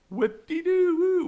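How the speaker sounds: sarcastic mock cheer.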